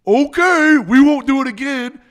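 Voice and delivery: high, loud whiny promise, hammed up; mock meek promise. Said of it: "as they go and do it again".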